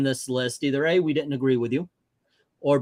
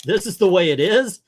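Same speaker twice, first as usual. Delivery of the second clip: emphatic retort; 5 semitones higher, 5 dB louder than usual. Retold or imitated. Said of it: "I'm saying".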